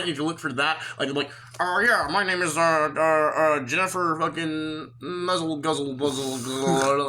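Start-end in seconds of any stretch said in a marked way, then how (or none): 1.6-7.1 slow, put-on silly caller voice; hammy fake voice stalling over a name; mock fake name voice. Salesman mocking a scammer's fake caller voice. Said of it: "doing a fake voice to pretend he's the other person".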